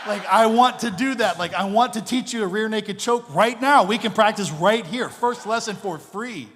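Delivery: loud, high, laughing yes yes yes; can't contain the glee; gleeful eager yell. Retold or imitated. Eager reply to learn jiu-jitsu.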